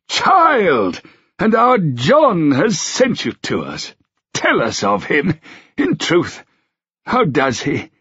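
put-on high, slow, fond old king's voice. A narrator's reading. Old king asking Melanthe about his son, fond and slow. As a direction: speak as a frail, doting old king.

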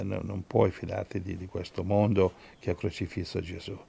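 soft, low pastoral prayer. Real, not acted. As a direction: pray quietly over the congregation, unhurried.

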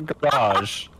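garage, British southern pronunciation; southern English accent. Shown as a demonstration. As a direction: say garage the way they do in southern England. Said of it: "That's how they say it in the south of England".

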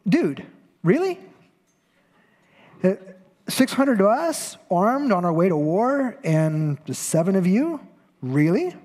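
high, slow, disbelieving "really?"; incredulous mock. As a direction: scoff at the tiny band of challengers. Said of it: "In other words".

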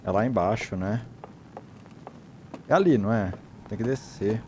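usual speaking voice

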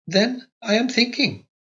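high, slow and thoughtful; reflective. A narrator's reading. Young wife musing to her husband, slow and reflective. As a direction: muse it slowly, high and light. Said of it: "said Carlotta reflectively".

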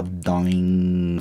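sustained "doing" imitating a guitar note; nota de guitarra imitada. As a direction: hum out one long plucked-string note. (imitando nota de guitarra)

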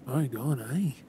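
normal talking voice

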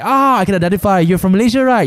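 high-pitched, pleased exclamation; light up as you place the accent; delighted recognition. Acted. A stranger recognises a Malaysian by accent, excited.

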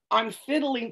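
ordinary talk, nothing marked

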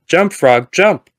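loud, high command shout; yelling at the frog. Retold or imitated. Scientist yelling at frog to jump. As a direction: bark the order at the frog. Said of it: "He yells at the frog".

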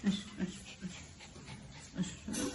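soft whispered come-here; breathy whisper to a pet; whispered coaxing. Real, not acted. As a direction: hush and coax it up. Coaxing pet onto bed.

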